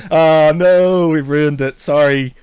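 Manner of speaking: usual speaking voice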